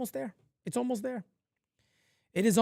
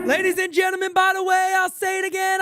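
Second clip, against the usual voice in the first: shouted aside; 8 semitones higher, 4 dB louder than usual. Real, not acted. Calling to the audience.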